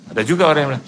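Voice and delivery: ordinary delivery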